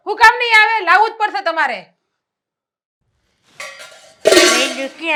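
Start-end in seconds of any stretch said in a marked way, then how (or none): 0.1-1.9 louder, higher challenge on કામ; indignant retort. Acted. Wife shouting back at her husband, indignant. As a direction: fire the question back at him.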